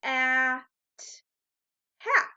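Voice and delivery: at, then hat, each said slowly and separately; teacher models at, then hat, one word at a time; sounding out words. Shown as a demonstration. Teacher sounding out at then hat for first graders. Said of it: "count the sounds in each word like this".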